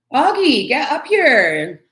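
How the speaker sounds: calling the cat. Real, not acted. Calling her cat to come upstairs, loud drawn-out call. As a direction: drag it out to call him up.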